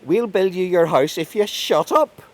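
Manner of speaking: very high, loud yell over the wailing; exasperated shouting. A narrator's reading. Fairy king gives in to the bawling man, shouting. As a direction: give in at the top of your voice. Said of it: "he just bawls again".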